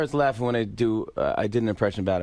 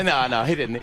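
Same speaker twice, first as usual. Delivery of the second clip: ordinary talk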